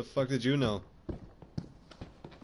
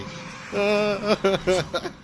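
nervous laugh; 8 semitones higher, 6 dB louder than usual. Real, not acted. Nervous laugh after a scare.

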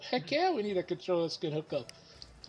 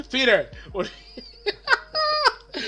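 laughing; 10 semitones higher, 5 dB louder than usual. Real, not acted. Laughing at lag in match.

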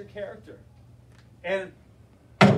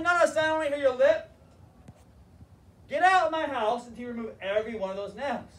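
scolding grandpa; 6 semitones higher, 4 dB louder than usual. Retold or imitated. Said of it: "Grandpa's told me".

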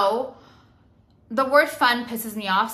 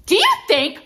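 high, loud angry challenge; sharply raised re-enacted yell; 8 semitones higher, 7 dB louder than usual. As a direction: yell it like a confrontation. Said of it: "I started yelling at people".

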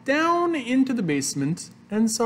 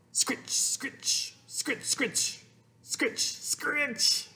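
soft, hushy, unvoiced scritches; scrubbing noises; 8 semitones higher, 8 dB quieter, much slower than usual. A narrator's reading. Reader imitates mother washing her mouth, scrubbing noises. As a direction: rasp out rhythmic whispered scrubs.

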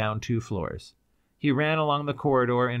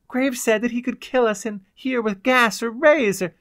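high, loud, urgent warning; girl's clear voice; 13 semitones higher, 6 dB louder than usual. A narrator's reading. The girl warns Cloud of gas, clear urgent voice. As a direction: warn him clearly, words tumbling on. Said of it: "the girl's clear voice broke in".

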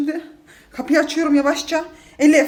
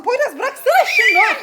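laughing shout; 8 semitones higher, 3 dB louder than usual. Real, not acted. Dog loose, son grabbing it.

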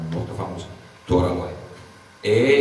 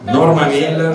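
laughing; 6 semitones higher, 9 dB louder than usual. Real, not acted. Laughing while announcing the recipe.

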